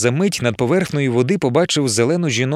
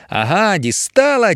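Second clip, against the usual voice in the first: high, slow triumphant cry; gloating; 7 semitones higher, slower than usual. Acted. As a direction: crow over the beaten snake. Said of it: "тішилася Емілія".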